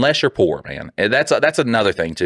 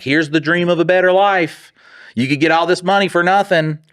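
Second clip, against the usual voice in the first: mock sales pitch; 9 semitones higher than usual. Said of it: "you're like".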